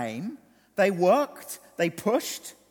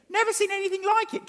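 pitched high in astonishment on NEVER; wide-eyed repeat, NEVER soaring high; amazed; 12 semitones higher than usual. Acted. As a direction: lift it up like you can't believe it. Preacher repeats the quote in amazement.

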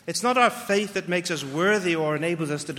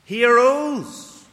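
proclaiming; 6 semitones higher, 6 dB louder, much slower than usual. Real, not acted. Preacher proclaiming the resurrection.

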